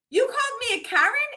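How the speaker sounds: retold affronted, high-pitched challenge; indignant question. Mom confronts girl from car window, indignant. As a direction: ask it high, in mock offense.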